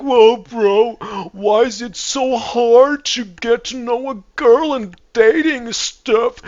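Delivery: high-pitched, drawn-out complaining whine; mock whining. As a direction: whine it loudly and slowly, imitating a self-pitying guy. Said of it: "they start whining about it".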